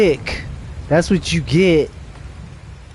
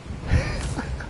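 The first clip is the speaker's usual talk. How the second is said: high squeaky laugh; 11 dB quieter than usual. Real, not acted. Laughs between repeats.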